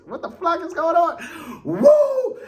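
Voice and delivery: high, slow outburst ending in a shrieked woo; unhinged Eagle Maniac going off; wild third-person hype voice, then a howl; worked-up fan persona. Acted. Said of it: "They got the Eagle Maniac a little bit worked up".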